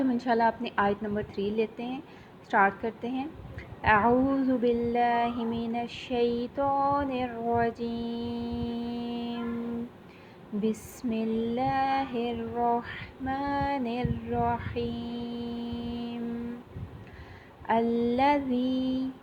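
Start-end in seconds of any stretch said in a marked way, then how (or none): 3.8-9.8 slow, drawn-out tajweed chant; Quranic recitation. Shown as a demonstration. Teacher reciting for the Quran class, slow and drawn out. 10.5-19.1 slow, drawn-out tajweed chant; Quranic recitation. Shown as a demonstration. Teacher reciting for the Quran class, slow and drawn out.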